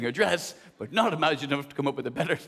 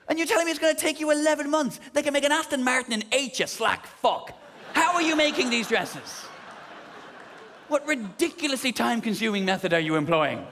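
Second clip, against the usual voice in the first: indignant rant; 7 semitones higher than usual. Acted. Ranting at the dressmaker.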